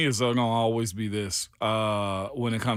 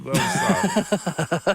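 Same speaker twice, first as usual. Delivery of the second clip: sarcastic; 6 semitones higher, 4 dB louder, much slower than usual. Real, not acted. Sarcastic praise slowly.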